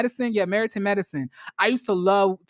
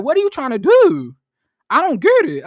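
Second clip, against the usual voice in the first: louder, higher, baffled outburst; mock exasperated yell; 8 semitones higher, 7 dB louder than usual. Host reacting to the show's fight, mock yell. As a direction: throw up your hands in mock disbelief. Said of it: "I was like".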